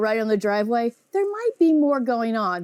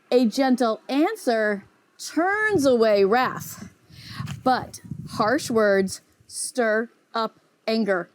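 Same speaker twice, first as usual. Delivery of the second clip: slow scripture reading; 2 semitones lower, slower than usual. Shown as a demonstration. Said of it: "it's Proverbs fifteen one".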